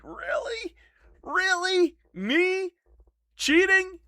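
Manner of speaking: high-pitched incredulous questions; mock disbelief. Streamer answering cheating accusations from viewers, mock incredulous. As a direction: squeak each word out as if falsely accused.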